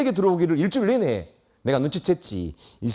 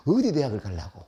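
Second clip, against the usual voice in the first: normal talking voice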